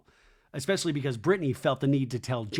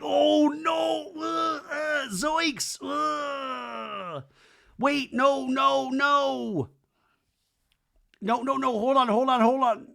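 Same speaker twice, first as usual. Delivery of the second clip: very high cartoon wail; hammed-up Scooby-style fretting; mock panic; 12 semitones higher, much slower than usual. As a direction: flail vocally with drawn-out, high-pitched alarm. Cartoonish imitation of Zach panicking.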